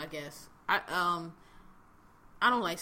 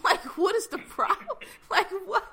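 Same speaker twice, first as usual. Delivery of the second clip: high-pitched, loud, laughing incredulity; exasperated and cracking up; laughing disbelief; 12 semitones higher, 7 dB louder than usual. Real, not acted. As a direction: laugh through the words, voice shooting up.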